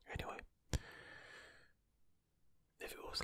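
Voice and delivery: normal talking voice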